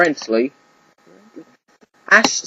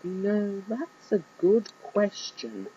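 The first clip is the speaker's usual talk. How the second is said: much softer, slow musing; puzzled; 4 semitones higher, 11 dB quieter, much slower than usual. Acted. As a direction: ponder it under the breath. Pikachu puzzled.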